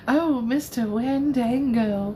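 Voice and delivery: slow, drawn-out Southern drawl. Pretend. Streamer reacting to a hunky man on a card, Southern voice. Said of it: "Why did I hear that in a southern voice?".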